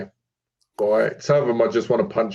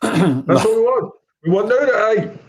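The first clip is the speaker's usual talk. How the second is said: mock eager demand; 9 semitones higher, 4 dB louder than usual. Joking chant about wanting nudity.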